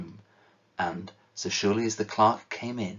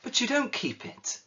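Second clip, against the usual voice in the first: much higher, stress on don't; protesting. Acted. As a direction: push back in amazement. Nephew protests.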